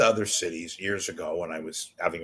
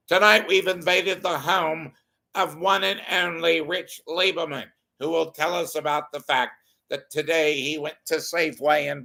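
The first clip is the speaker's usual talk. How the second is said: higher, loud, hammed-up celebrity TV host; a deliberately bad Robin Leach, grand and showy; Robin Leach impression; 6 semitones higher, 4 dB louder than usual. A radio host doing a bad Robin Leach for listeners. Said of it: "my Robin Leach sucks".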